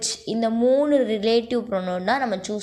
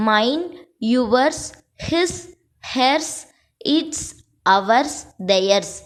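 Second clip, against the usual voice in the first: word by word, with a clear pause after each; measured dictation, spaced out for copying; dictating; 3 semitones higher, much slower than usual. Real, not acted. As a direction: dictate the list one word at a time. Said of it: "நான் dictate மட்டும் தான் பண்றேன்".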